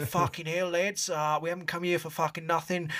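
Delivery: put-on high, rowdy broad Australian accent, sweary; bogan Aussie team talk. Under-eighteen Australian team psyching up before the final, in a broad Aussie accent. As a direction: ham up a broad Aussie lad firing up his team. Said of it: "the most bogan Aussie pre-team talk".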